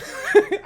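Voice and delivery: high bright giggle. Real, not acted. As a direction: burst into a quick giggle. Laughing mid-story.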